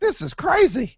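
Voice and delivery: amazed. Retold or imitated. Meeting a fan Uber driver, amazed and high. Reacting to the coincidence.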